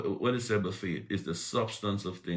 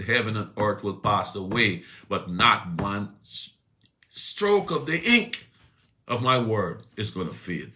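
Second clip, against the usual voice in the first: louder, stirring preaching voice; 4 semitones higher, 8 dB louder than usual. Acted. Preacher quoting God's word to the church, preached loud. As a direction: press the promise home to the church.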